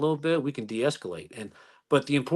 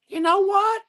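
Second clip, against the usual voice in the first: put-on high, squawky old lady voice, much higher; Jimmy Neutron's teacher; 5 dB louder, slower than usual. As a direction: mimic a fussy retired lawyer in a high screech. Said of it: "It sounded like, uh, Jimmy Neutron's teacher".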